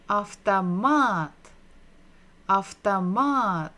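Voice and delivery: "автомат" joined up slowly, stressed on the final MAT; whole word, slowly. Shown as a demonstration. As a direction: blend the syllables into one deliberate "автомат". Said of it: "if we're going to read it together".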